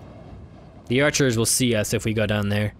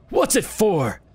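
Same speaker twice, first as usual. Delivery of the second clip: put-on old beggar voice; 5 semitones higher, slower than usual.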